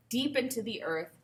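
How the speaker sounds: normal talking voice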